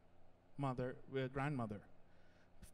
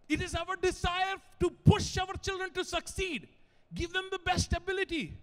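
loud, high, rousing pulpit delivery; full-force preaching; 8 semitones higher, 9 dB louder than usual. Acted. Preacher urging mothers on their important role, loud and fervent. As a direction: drive it home to the moms.

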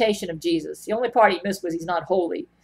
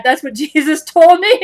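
laughing; 8 semitones higher, 10 dB louder than usual. Real, not acted. Laughing at her own story.

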